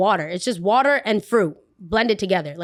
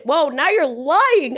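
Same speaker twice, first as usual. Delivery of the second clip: high, slow, drawn-out whoa; outraged slow protest; 7 semitones higher, 4 dB louder, slower than usual. Retold or imitated. Retold to viewers, calling out a lying coworker, slow outraged protest. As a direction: stretch it out in disbelief. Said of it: "I said".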